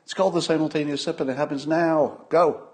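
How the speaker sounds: building, grand ceremonial announcement; ritual recital. Acted.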